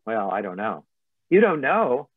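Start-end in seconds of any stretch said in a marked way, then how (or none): 1.3-2.1 higher, louder disbelieving question; incredulous echo. Retold or imitated. Coach echoes client's doubt, incredulous and louder. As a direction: throw it back at them in disbelief.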